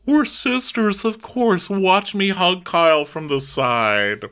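high, then slow, drawn mock sweetness; falsely sweet, slowing down at the end; mocking Kathy. Host mocking Kathy's fake sisterly hug, high then slow. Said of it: "Kathy just goes".

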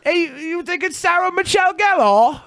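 high mock-British question; British accent, lifting "Gellar" at the end; British question lilt. Shown as a demonstration. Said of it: "raise your inflection at the end".

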